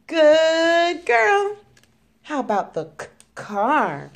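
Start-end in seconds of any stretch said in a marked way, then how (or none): 0.1-1.6 excited praise. Real, not acted. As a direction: cheer her on. Praising a child at play.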